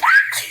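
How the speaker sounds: a jump-scare shriek; scream. Retold or imitated. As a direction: shriek in fright. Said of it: "and I was like".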